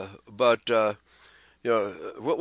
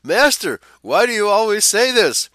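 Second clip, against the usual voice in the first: high, loud exasperated plea; hammed-up pleading of weary disciples; weary complaint; 8 semitones higher, 7 dB louder than usual. A narrator's reading. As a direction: read it as a raised, amused protest. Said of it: "wearied at always hearing the same words".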